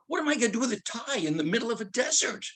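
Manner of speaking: hammed-up frantic incredulity; incredulous outburst. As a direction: throw it up high in desperate disbelief. Same question, more worked up.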